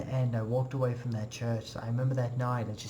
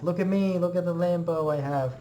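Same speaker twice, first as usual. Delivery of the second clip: needy show-off; 3 semitones higher than usual. Retold or imitated. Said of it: "They wanna be like".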